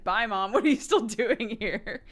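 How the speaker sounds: mock-impatient little kid; dismissive kid. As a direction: shoo mom away like a kid. Child dismissing mom on seeing friend.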